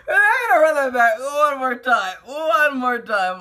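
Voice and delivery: breathless from laughing, still crying; after laughing hard. Real, not acted. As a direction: talk while recovering from a laughing fit. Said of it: "I'm crying".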